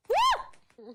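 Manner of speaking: high excited whoop; cheer. Real, not acted. Streamer whooping at a cat video. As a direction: let out a whoop.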